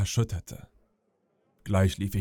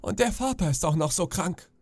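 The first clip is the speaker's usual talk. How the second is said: higher woeful mother; lamenting mother; 8 semitones higher than usual. Acted. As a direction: lament the misery. (klagende Mutter, höher)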